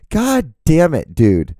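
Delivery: slow, loud exasperated outburst; frustrated crew guy. Retold or imitated. Crew member after the shooting on set, loud exasperated outburst. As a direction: bark it out in disbelief. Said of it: "It's like".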